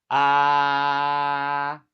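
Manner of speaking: "Ah" held long, high and level; first tone. Shown as a demonstration. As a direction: sing "Ah" as one steady note. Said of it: "like singing a high note. Hold it".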